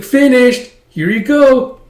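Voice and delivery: put-on high, loud girlish voice; pleased announcement. Girl handing back the mended jacket, pleased. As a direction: announce it proudly, pitched well up.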